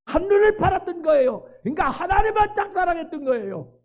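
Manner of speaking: high, loud preacher's cry; fervent preaching. Acted. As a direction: preach it out with full force. Preaching at full force.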